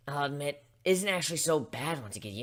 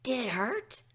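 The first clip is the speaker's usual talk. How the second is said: nervous filly; 10 semitones higher than usual. Acted. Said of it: "Scootaloo asked nervously".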